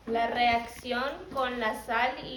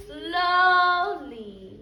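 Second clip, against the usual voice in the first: slow, stretched; 6 semitones higher, 5 dB louder than usual. Acted. Reading slowly, stretched.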